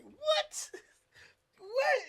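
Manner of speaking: half-laughing shock at the news; stunned disbelief. Retold or imitated. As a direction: react like you can't believe it. Shocked at news of knife chase.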